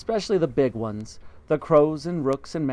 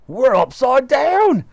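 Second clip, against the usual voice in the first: higher, louder, slow aghast cry; husband aghast at being upside down; gasped; 11 semitones higher, 10 dB louder, slower than usual. Acted. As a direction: gasp it out aghast. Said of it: "gasped Mister Twit".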